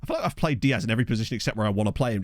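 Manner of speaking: ordinary talk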